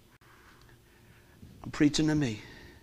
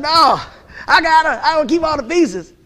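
high, loud mock-stubborn no; stubborn refusal; 7 semitones higher, 7 dB louder than usual. Pastor retelling refusing God's request, mock stubborn. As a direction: dig in like a sulking kid, hammed up. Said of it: "And I said".

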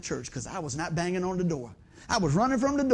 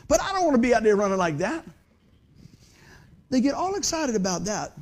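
ordinary talk, nothing marked

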